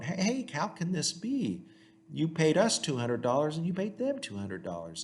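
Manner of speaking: high-pitched disbelief, "how can this be"; incredulous workers. Retold or imitated. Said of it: "are incredulous".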